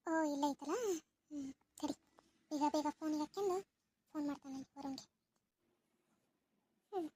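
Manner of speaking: very soft, hushed words so nobody at home hears; hushed secret voice. Acted. Girl sneaks a call to Varun, hushed and very soft. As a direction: keep it down, sneaking a phone call.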